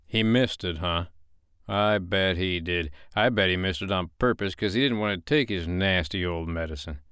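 ordinary delivery, nothing marked

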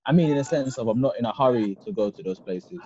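ordinary delivery

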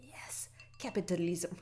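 put-on heavy Russian accent, slow and hushed; whispered mock Russian professor. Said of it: "in the Russian accent".

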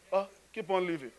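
normal talking voice

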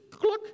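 quick high cluck; short high hen noise; chicken cluck. A narrator's reading. Animals scramble into truck.

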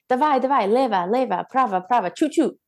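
lightly voiced Ukrainian dance captain calling out counts; dance captain commands. A dancer imitates her Ukrainian captain calling steps, lightly mocking. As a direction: bark out the steps like a mild mock drill captain. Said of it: "my dance captain was also Ukrainian".

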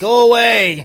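loud shouted go away; a mock yell at the police at the door; retold yell. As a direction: bark it out to drive them off. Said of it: "You hear them yelling".

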